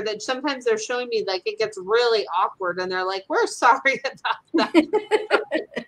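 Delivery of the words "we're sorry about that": laughing cat apology. Pretend. Cats apologizing to Jeff, slow and laughing. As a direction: giggle out the cats' sorry.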